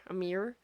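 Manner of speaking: "mirror" said as "meer"; one-syllable Midwestern. Shown as a demonstration. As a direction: run "mirror" together into a single syllable. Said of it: "like, M E E R".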